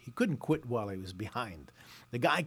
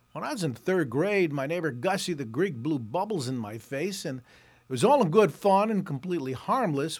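usual speaking voice

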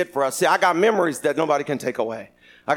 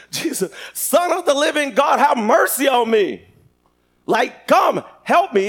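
raised, urgent acted plea; crying out; 6 semitones higher, 3 dB louder than usual. Blind Bartimaeus screaming out to God for mercy, crying out. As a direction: call out higher, pleading for help. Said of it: "He was screaming out to God".

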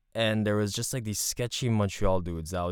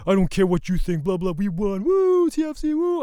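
much higher, louder gloating jock whoop; douchebag fan; 9 semitones higher, 5 dB louder than usual. Retold or imitated. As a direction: crow the win in his face. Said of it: "the guy responds as a douchebag".

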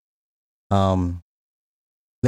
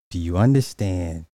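normal talking voice

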